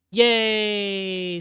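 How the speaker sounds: loud, high cheer of relief; happy cheer. Real, not acted. Streamer cheering after the moderator bans the troll, loud. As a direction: celebrate like the win just happened.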